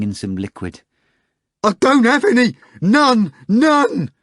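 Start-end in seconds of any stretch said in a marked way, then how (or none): 1.6-4.1 very high, loud desperate shriek; terrible cry. Acted. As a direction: scream it in panic. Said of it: "cried in a terrible voice".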